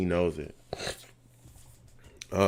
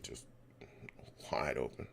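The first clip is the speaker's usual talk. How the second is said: stunned whisper; 20 dB quieter than usual. Real, not acted. Stunned at open receiver.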